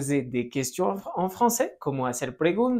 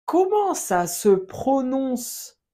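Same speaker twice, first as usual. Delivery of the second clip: slow, higher, carefully pronounced teacher model; slow model phrase; 7 semitones higher, slower than usual. Shown as a demonstration. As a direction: spell it out slowly for learners to copy. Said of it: "Répète". (frase modelo lenta y aguda)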